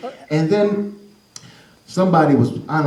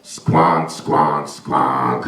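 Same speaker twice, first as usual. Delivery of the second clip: low honking sax imitation; deep squonking horn noise; bad saxophone squonk; much slower than usual. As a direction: honk like a badly blown sax. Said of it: "it sounded like this".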